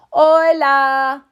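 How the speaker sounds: high, loud greeting call; hollering. Acted. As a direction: holler back to your buddies. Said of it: "hollered back Skipito Frisquito".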